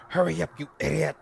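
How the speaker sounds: quick, loud, hammed-up snap; mock impatient snap. Helen urging Ernest to finish the job, quick mock snap. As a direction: rush him like a fed-up schemer.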